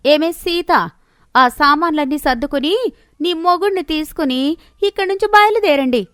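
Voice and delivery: building from sneer to sharp command; haughty sister-in-law. Acted. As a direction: order the couple out of the house.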